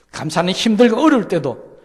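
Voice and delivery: loud, high, ringing climax of the sermon; fervent preaching. Real, not acted. Pastor crying out God's promise to the congregation, loud and high. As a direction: proclaim it at full voice to the whole room.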